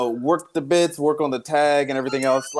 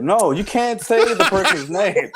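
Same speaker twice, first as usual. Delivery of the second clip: laughing protest; 7 semitones higher than usual. Retold or imitated. Laughing at rule breach.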